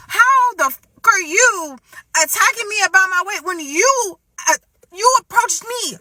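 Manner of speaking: angrily retelling her comeback to the rude date; retold anger. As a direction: let the insult sting you again. Retells angry reply to date.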